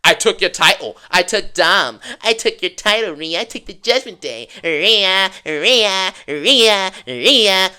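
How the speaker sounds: lightly voiced taunting Liv Morgan; a hint of a repetitive smug taunt; mocking Liv Morgan. Vlogger mocking Liv's repeated promos, lightly voiced. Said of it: "saying the same stuff each time".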